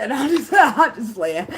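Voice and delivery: laughing through the words, high and loud. Real, not acted. Backing off her murder joke to the listeners, laughing. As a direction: giggle while taking the threat back.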